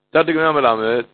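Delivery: ordinary talk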